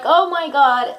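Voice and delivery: retold burst of overjoyed disbelief; super ecstatic. Girlfriend throwing herself at Carlos, ecstatic. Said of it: "super ecstatic".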